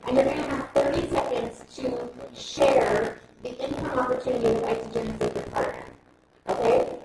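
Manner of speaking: loud workshop commands over the crowd; energetic seminar coach barking instructions; calling out to the room. Real, not acted. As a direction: project to everyone standing up. Coaching the room through an exercise.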